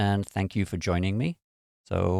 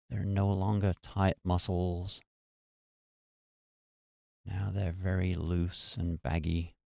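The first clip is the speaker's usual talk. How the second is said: guided meditation; 4 dB quieter than usual. Real, not acted. Meditation host talking listeners through releasing muscle tension, guided meditation. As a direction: ease them gently into relaxation, unhurried and even.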